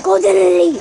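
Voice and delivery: gasp, then a loud eager shout; sudden thrilled callout; excited gasp. Real, not acted. Spots rare enemy, gasps.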